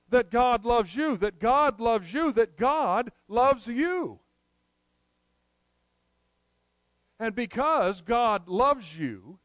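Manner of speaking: slow, loud, building repetition; preaching at full force. Real, not acted. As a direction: hammer home each "God loves you". Preacher repeats God loves you.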